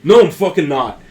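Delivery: put-on loud, gruff and without the Irish accent; loud gruff retort. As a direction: snap it back hard, no Irish accent. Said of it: "no, that was Irish".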